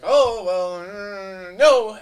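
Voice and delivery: mock big-company editor, rising to a loud high no; hammed-up dismissive refusal; snooty editor. Big company editor rejecting a writer's choice, mock snooty. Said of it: "they would be like".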